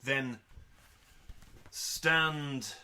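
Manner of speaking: ordinary delivery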